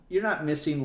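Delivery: usual speaking voice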